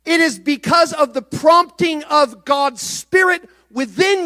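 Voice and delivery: sermon climbing to a fervent plea; impassioned preaching. Acted. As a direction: pour it out from the pulpit. Preaching builds to full force.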